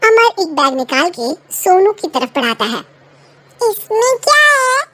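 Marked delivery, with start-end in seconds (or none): 3.6-4.8 put-on slow, higher boy's voice; a boy wondering what's inside; curious child. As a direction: son eyeing father's bag. Child asks about bag.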